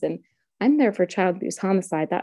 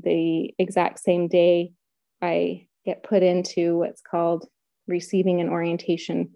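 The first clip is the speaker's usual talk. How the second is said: ordinary talk, nothing marked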